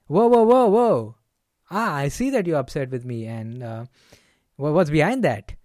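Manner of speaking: meet the charge head-on, then ease into the question; loud whoa to match an upset friend; matching their energy. Shown as a demonstration. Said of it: "the energy is a bit different".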